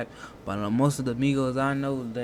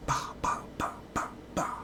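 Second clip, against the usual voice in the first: soft unvoiced bah bah gunfire; breathy mock gunshot sounds; imitated gunshots; 9 dB quieter than usual.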